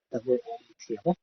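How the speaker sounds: normal talking voice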